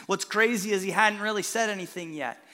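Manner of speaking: usual speaking voice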